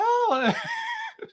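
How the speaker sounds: high-pitched laugh; high burst of laughter. Real, not acted. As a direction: let out a squeaky laugh. Laughing after reveal.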